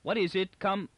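high urgent question cut short; high, alarmed, broken off; choked off. A narrator's reading. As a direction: call out, then break off sharply. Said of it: "Beta's voice choked off sharply".

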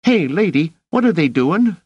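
put-on high, loud boy's voice; squeaky, alarmed question; alarmed street kid. A narrator's reading. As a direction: call out urgently as a scrappy kid. Alarmed boy calls to the lady.

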